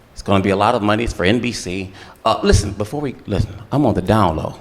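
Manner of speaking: low, hushed producer voice; confiding producer. Retold or imitated. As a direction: drop low to confide a secret.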